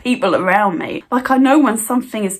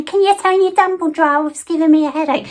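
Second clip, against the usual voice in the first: higher, nagging complaint; mock whiny neighbour; 5 semitones higher, faster than usual. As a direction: whine it like a fussy neighbour. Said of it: "Except that woman".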